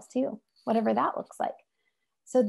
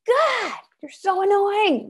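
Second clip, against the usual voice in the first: much higher, louder and slow, fed up; exasperated; 10 semitones higher, 4 dB louder, slower than usual. Retold or imitated. Parent fed up with a crying baby, slow exasperated groan. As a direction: drag it out like you've had enough.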